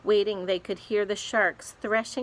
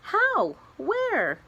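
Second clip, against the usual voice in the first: excited; 13 semitones higher, 4 dB louder than usual. Acted. Grasshopper excited about silkworm.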